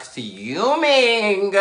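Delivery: FUMING drawn out long and high; stress on fuming. Retold or imitated. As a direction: stretch out the word fuming, pitch swooping up. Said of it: "I'm mad as fuck".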